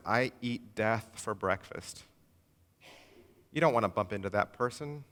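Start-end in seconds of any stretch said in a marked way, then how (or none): 0.1-2.0 low, slow and dark, softer; bleak despair. Retold or imitated. Pastor voicing the psalmist, slow and grim. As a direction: let each word sink like a dirge. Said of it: "The author says".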